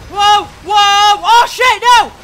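loud, high alarmed yelps; alarmed shout. Real, not acted. Gamer caught by an attack, alarmed shouting. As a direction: yell in sudden alarm. Getting hit unexpectedly.

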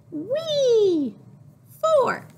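high loud gleeful whee; excited whee. Acted. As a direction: squeal with delight. Piggy jumping on trampoline.

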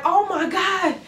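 high-pitched amazed onlookers; shocked and surprised. Acted. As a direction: act out a crowd in astonishment. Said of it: "shocked and surprised".

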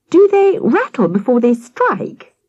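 put-on high, hushed wonder of a small boy; awed. Peter to the policeman, in awe about snakes. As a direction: ask it wide-eyed, amazed. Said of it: "asked Peter in awe".

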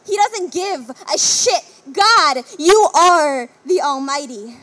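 loud, forceful outcry to God; impassioned, slam-poem outburst; angry accusation. Acted. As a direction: hurl it upward as a loud accusation.

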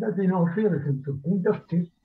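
ordinary delivery

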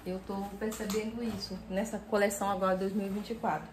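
ordinary talk, nothing marked

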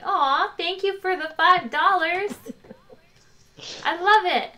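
high and loud, gushing over a donation; bright thrilled thank-you; delighted thanks. Real, not acted. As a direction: gush with real delight. Thanking donor excitedly.

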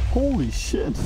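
usual speaking voice

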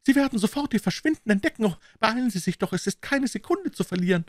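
high urgent woman's voice; hushed, hurried warning; being chased. Acted. Woman warns to flee.

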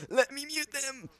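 high squeaky muttering. Real, not acted. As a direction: pitch it way up, slowly. Fumbling with controls, squeaky voice.

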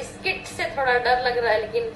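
ordinary delivery

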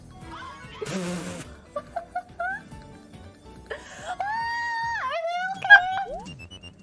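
high squealing burst of laughter; shrieking laugh. Real, not acted. Loud laugh at the clip.

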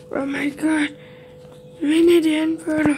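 ordinary delivery

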